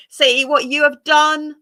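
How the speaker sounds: high, loud scream. A narrator's reading. Melting witch screaming at Dorothy in loud panic. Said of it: "she screamed".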